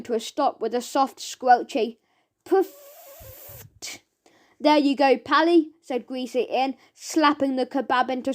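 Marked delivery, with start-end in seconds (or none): 2.4-3.6 a tiny high-pitched pop; squeaky puff. A narrator's reading. Sound word at stop. 4.6-5.7 louder, chummy shop-owner voice; greasy cook. Acted. Greasy Ian serving Mister Gum, louder and chummy.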